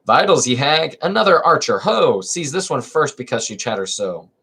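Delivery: lively theatrical reading of a verse comedy; dramatic play reading. Acted. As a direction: read the chorus lines with stage energy. Reads magistrate.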